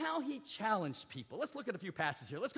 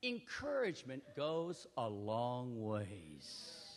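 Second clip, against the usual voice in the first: lower, softer, drawn out; slow emphasis; 9 semitones lower, 5 dB quieter, much slower than usual. Real, not acted. Preacher to congregation, slow soft emphasis. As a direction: let the point sink in. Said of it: "preacher's slow emphatic point".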